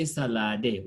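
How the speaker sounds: salade said as "sa-la-deh" with the final E voiced; wrong, final E sounded. Shown as a demonstration. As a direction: sound the silent E at the end of salade. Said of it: "we don't say".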